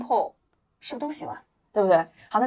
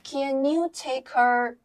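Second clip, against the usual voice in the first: CAN YOU TAKE HER very drawn out, raised pitch; slow linked reading; 4 semitones higher, much slower than usual. Shown as a demonstration. Teacher models the phrase for learners, slow linked reading. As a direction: stretch each part, linking CAN into YOU. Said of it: "有个连读".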